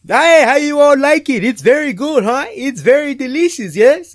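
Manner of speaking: put-on loud, high, proud host in an African accent. Host asking guests about his dish, loud proud African accent. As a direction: beam and fish for praise in an African accent. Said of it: "he's like".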